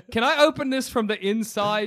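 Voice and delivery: ordinary delivery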